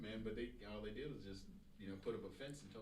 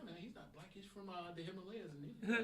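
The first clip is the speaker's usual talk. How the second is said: much higher, rising to loud; high imitating boss voice; 11 semitones higher, 6 dB louder than usual. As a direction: play the boss, high and bright. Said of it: "He said".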